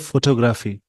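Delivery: फोटोग्राफी said with full "foto-graafi" o vowels; common pronunciation. Shown as a demonstration. Teacher showing learners how many people say it, common pronunciation. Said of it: "बहुत सारे इसको बोलते हैं".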